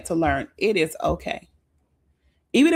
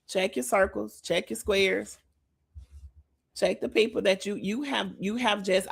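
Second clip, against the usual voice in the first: ordinary talk, nothing marked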